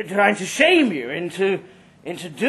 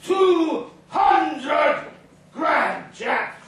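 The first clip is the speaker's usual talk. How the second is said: put-on drunken shout; 9 semitones higher, much slower than usual. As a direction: bellow it out like a man full of Christmas cheer. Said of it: "He was shouting when I spotted him".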